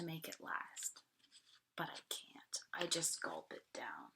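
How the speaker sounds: whispered confession. A narrator's reading. Narrator whispering to listening children. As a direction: hush it out. Unvoiced line in story.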